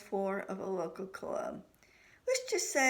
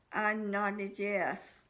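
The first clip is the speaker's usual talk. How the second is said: plain reading voice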